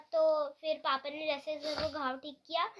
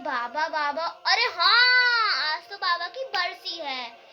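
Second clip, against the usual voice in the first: sudden remembering; 2 semitones higher, 8 dB louder, slower than usual. Retold or imitated. Mom suddenly remembers the anniversary.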